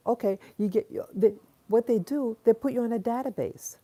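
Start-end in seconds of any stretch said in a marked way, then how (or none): none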